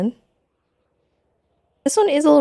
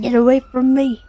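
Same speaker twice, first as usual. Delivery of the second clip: in a tunnel; 3 semitones higher, 4 dB louder than usual. Real, not acted. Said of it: "Why do you sound like you're in a tunnel?".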